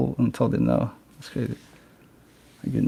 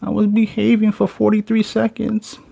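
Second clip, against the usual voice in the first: put-on high, pleading child voice; mock a kid begging to get his toy back; playfully imitating a child who wants his stuff back after barely behaving; whiny kid begging; 9 semitones higher than usual. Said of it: "be like".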